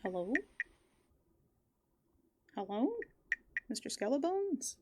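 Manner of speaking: usual speaking voice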